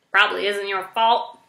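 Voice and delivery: usual speaking voice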